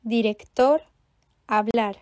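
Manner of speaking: "Director, hablar" stressed direc-TOR, ha-BLAR; accent sur la dernière. Shown as a demonstration. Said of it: "accentués sur la dernière syllabe". (« Director, hablar » accentués sur la dernière syllabe)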